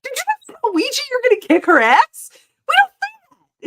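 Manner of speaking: high, loud, fast mock scolding; scolding parent. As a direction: play the shocked parent correcting the kid. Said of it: "And I'm like".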